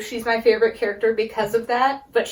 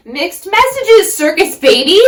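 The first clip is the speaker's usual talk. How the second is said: exasperated shout; 7 semitones higher, 9 dB louder than usual. Real, not acted. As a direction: yell it at the character in disbelief. Exasperated address to character.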